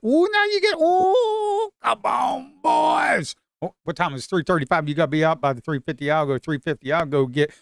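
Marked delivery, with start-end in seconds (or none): 0.0-3.3 very high, loud cheering whoop; excited whoop. Real, not acted. Trader whooping at buyers as price runs, high loud cheer. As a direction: shout the buyers home, delighted.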